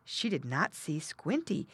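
ordinary delivery

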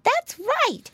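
very high little piglet voice; agreeing piggy boy. A narrator's reading. Piglet agreeing with brother, high eager voice. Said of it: "agreed the other piggy boy".

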